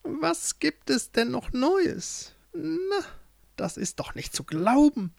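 high-pitched witch musing to herself; scornful witch. Acted. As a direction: play a mocking witch, sing-song and high. (spöttische, hohe Hexenstimme)

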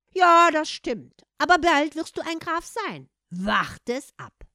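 very loud, slow, drawn-out confidence; emphatic assurance. A narrator's reading. Cat promises Hans a title, emphatic assurance. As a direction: proclaim it with relish, stretching the words.

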